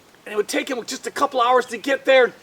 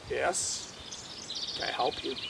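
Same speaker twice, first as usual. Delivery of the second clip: much lower, soft and kind; gentle and unhurried; calm low Jesus voice; 11 semitones lower, 11 dB quieter, much slower than usual. Acted.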